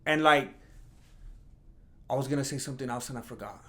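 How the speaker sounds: ordinary talk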